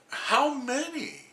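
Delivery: drawn-out slow disbelief; slow incredulous. Real, not acted. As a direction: stretch it out, stunned. Repeated incredulous question.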